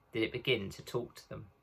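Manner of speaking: usual speaking voice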